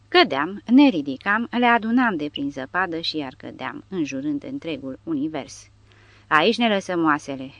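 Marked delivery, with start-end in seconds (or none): none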